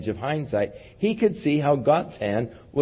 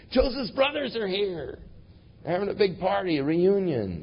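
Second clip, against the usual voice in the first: excited servants; 8 semitones higher, 5 dB quieter than usual. Retold or imitated. Servants report excitedly to Pharaoh.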